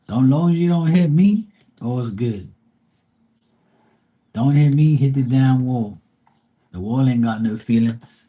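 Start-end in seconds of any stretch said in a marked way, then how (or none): 0.1-1.5 higher and louder, fed up; exasperated. Real, not acted. Ranting at reckless drivers. 4.3-6.0 louder, firm warning; emphatic. Real, not acted. Ranting at reckless drivers.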